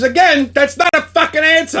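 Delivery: snapping back at the phone rep; exasperated retold outburst; retold angry retort.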